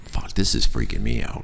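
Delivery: low and soft, nearly whispered; hushed, unnerved aside. Retold or imitated. Alone driving through a blizzard, hushed unnerved aside. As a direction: say it under your breath to yourself.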